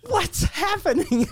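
high, drawn-out mock panic; freaking out. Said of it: "Like, she's freaking out".

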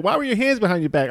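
high, quick accusing question; mock suspicious wife. Katie questioning husband, quick high suspicion.

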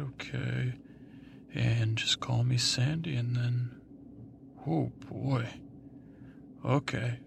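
usual speaking voice

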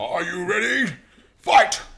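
fight announcer. Pretend. Mock fight announcer call.

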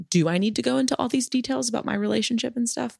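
retold self-questioning. Podcaster recalls doubting her personal story, retold self-questioning. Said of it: "I did question a lot".

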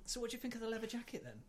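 high, quick retold question; delivery guy in tights. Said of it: "And he goes".

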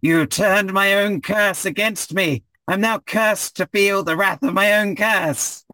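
high, slow, strained villain voice; slow high bitter lament; cursed villain. Acted. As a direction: drag it out in outraged pain. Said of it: "He says".

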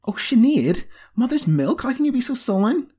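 very high, pleading outburst; exasperated exclamation. A narrator's reading. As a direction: cry out at a glum mistress. Apprentice exclaims at unresponsive mistress.

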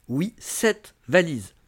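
"sept valises" drawn out slowly, word by word; incredulous emphasis. Real, not acted. Vlogger telling viewers about a guest's absurd luggage, slow emphasis on "sept valises". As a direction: let "sept" and "valises" each land after a pause. Stressing the absurd suitcase count.

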